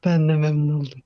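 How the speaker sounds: loud faked male voice; forced deep voice. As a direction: boom it out like a guy. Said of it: "Ben de kız olup erkek sesi çıkarıyorum".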